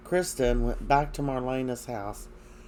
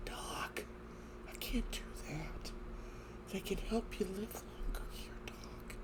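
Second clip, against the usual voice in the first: tender whisper; 7 semitones higher, 15 dB quieter than usual. Retold or imitated. John gently refuses dying Marlena.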